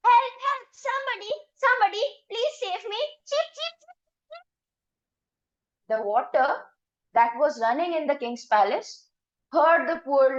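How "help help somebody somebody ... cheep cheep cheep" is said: high, breathless pleas ending in cheeps; chick crying for help. A narrator's reading. Chicken chased by the cook, crying for help high. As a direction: cry out like a chased little chick. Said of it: "crying for help".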